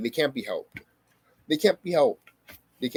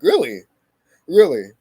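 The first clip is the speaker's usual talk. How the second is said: retold incredulous retort; 9 dB louder than usual. Acted incredulous retort to friends.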